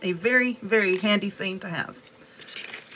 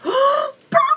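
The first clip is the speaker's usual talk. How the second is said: gasp, then squeal it much higher; gasping delight; 6 dB louder than usual. Real, not acted. Unboxer gasps at the purple polish, delighted. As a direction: burst out in sudden delight. Opening a door, sees purple.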